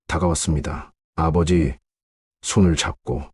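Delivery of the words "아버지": plain reading voice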